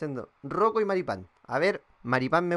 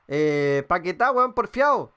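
excited exclaim; 3 semitones higher, 5 dB louder, slower than usual. Real, not acted. Exclaiming at a stubborn player.

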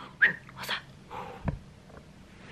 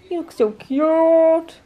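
loud, higher, very drawn-out coo; gushing coo; 6 semitones higher, 5 dB louder, much slower than usual. Real, not acted. Owner admiring the pug in a little towel, gushing. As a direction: stretch it out slowly, gushing. Gushing over dog's photo.

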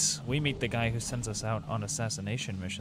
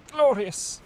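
delighted; 13 semitones higher, 9 dB louder than usual. Real, not acted. Delighted at finding item.

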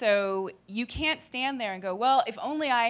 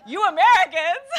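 put-on higher, louder French accent through a laugh; 10 semitones higher, 14 dB louder than usual. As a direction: tease the Americans in a French accent. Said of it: "You're right, Shala".

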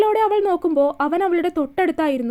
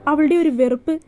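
ordinary delivery

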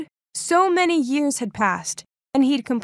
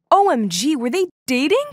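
loud shocked gasp of words; 4 semitones higher, 5 dB louder than usual. A narrator's reading. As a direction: blurt it out in disbelief. Shocked realisation.

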